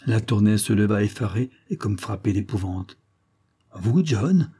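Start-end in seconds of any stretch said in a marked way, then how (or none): 3.8-4.5 cry out. A narrator's reading. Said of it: "s'écria-t-il".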